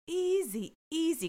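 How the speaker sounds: high, soothing "easy, easy"; imitated calming, pitched well up; good-cop calming. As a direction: play the good cop talking someone down. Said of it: "she's decided to be good cop".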